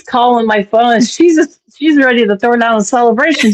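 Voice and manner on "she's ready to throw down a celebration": ordinary talk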